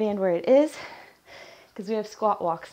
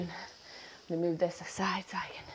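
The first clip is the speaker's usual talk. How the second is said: whispered softly to herself while moving gear; whispered aside; 3 semitones lower, 8 dB quieter than usual. Real, not acted.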